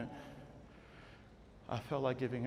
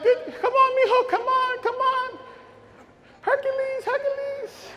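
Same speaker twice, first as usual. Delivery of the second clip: high, eager mock cheer from the stands; cheering parents. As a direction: imitate parents urging him on, pitched high. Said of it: "they were screaming and yelling".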